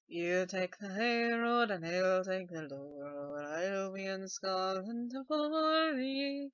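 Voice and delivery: slow Scottish song voice; sing-speak it in a Scottish accent. Shown as a demonstration. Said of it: "I might as well go with this one".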